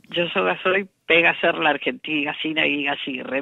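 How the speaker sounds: her name coded as "pega a ser la argentina, Gacina y Gacirre" with inserted syllables; rosarino slang. Shown as a demonstration. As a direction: rattle off her own name in the Rosario jargon. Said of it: "se lo dije todo así". (su nombre dicho en jerga rosarina: "pega a ser la argentina, Gacina y Gacirre")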